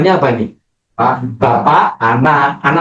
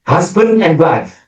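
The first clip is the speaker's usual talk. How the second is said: mock pastor; 8 semitones higher than usual. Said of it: "ya, saya berkata".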